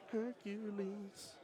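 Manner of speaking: very high, slow, stretched announcer cry; mock-epic arena announcer; epic hero call. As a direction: sing out the name like a legend's entrance. Hyping the hero combo shot.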